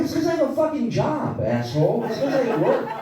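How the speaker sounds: high-pitched comic outrage; hammed-up exasperated gamer; indignant retort. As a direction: fire back at a mocking kid, voice shooting way up. Retort to kids beating him at games.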